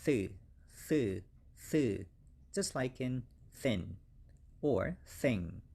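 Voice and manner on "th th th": "th" dengan lidah di antara gigi; th sound drill. Shown as a demonstration. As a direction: hiss the th between teeth. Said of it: "very difficult English pronunciation".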